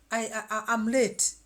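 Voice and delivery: loud "I'm late" burst of urgency; mock urgency. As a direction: blurt "I'm late" in a panic. Acting out her inner rush.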